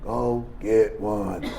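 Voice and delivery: low, slow, hushed tempting inner voice; a quiet voice luring him back to using; the addiction whispering at him, low and slow; the disease talking. Retold or imitated. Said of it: "The disease told me".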